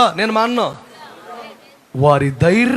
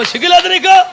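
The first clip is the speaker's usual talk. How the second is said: scolding; 8 semitones higher than usual. Real, not acted. Scolding fearful believers.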